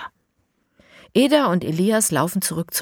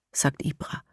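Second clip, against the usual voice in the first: plain reading voice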